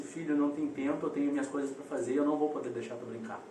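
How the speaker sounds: low, soft, quick motherly refusal, lightly voiced; mother refusing.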